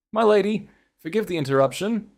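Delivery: high, polite butler announcing himself; butler's voice. Acted.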